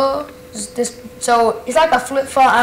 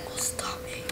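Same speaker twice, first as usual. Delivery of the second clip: whisper; 20 dB quieter than usual. Acted. Villainous vow after boast.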